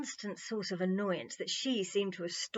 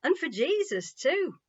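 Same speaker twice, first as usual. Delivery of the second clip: amused; 9 semitones higher, 8 dB louder than usual. A narrator's reading. John teasing, amused.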